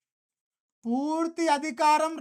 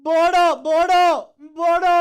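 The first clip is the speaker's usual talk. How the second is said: Bodo called out loud and higher, three times; stressed repetition; 4 semitones higher, 10 dB louder, much slower than usual. Shown as a demonstration. Teacher drilling new terms for aspirants, punching Bodo loudly. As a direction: drum the word Bodo into them loudly. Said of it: "ఒక్కొక్క పదాన్ని ఇంత stress చేసి".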